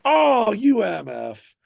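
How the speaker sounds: loud, slow teasing shout; drawn-out playful shout; mock outrage. Hearing a friend played with him first, teasing shout. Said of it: "And I'm like".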